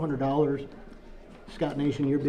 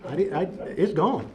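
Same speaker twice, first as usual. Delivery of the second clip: usual speaking voice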